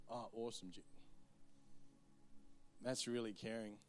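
sweetly sarcastic, overdone gratitude; sarcastic gush. Acted. Woman's sarcastic imagined reply.

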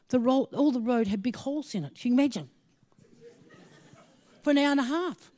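ordinary talk

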